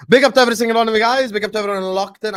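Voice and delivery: high, fast, loud shout-out; fast hyped host catchphrase. Acted. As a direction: fire up the viewers. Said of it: "channel shout-out catchphrase".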